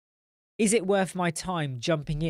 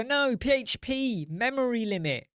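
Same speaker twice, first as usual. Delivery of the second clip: patient correction; 8 semitones higher than usual. Retold or imitated. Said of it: "I'll go".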